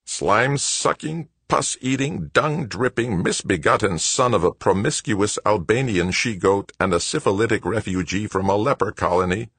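high-pitched, measured litany of curses; methodical cursing. A narrator's reading. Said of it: "fervent litany of methodical, dispassionate cursing".

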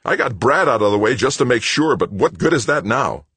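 loud, high, bitter ranting; worked-up, forceful complaint; agitated outburst. A narrator's reading. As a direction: rage at how pointless it was. Son's furious outburst at mother.

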